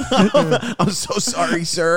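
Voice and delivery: high, drawn-out apology through laughter; mock grovelling apology. Host playing along as if facing the gunman, mock apologetic. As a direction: plead slowly, laughing at the act.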